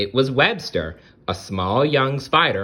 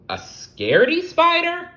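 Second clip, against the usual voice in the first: very high, louder startled question; surprised; 6 dB louder than usual. Acted. Hermie hearing Webster is scared, amazed high. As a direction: sound amazed. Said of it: "Hermie was surprised".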